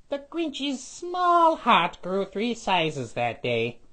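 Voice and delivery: ordinary delivery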